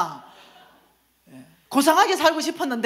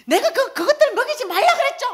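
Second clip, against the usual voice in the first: very high, loud mock tirade; hammed-up screech of reproach; angry yelling; 6 dB louder than usual. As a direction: scold her at full shout. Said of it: "나보고 고함을 지르는 거예요".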